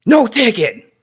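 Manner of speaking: high, loud mock shout; imitated yell. Reviewer quoting Indy yelling at the boarders, high loud yell. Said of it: "he yells at the boarders".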